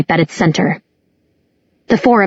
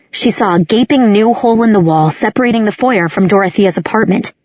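ordinary delivery

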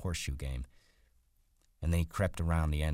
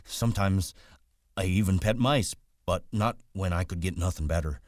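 put-on raised, louder voice of a childlike man; simple, eager Lennie figure; 3 semitones higher, 6 dB louder than usual. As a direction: play an eager, simple-minded farmhand.

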